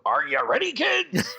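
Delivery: high, loud pirate roll call. Acted. Asking whether the escape room host played pirate, mock pirate call. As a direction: holler it like a cartoon pirate captain. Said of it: "talking like a pirate".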